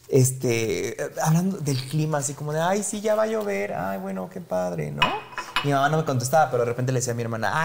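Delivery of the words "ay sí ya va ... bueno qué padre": raised, airy pretend chit-chat; mock small talk. Son imitating awkward café small talk with mom, mock airy. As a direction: lightly play the forced polite weather talk.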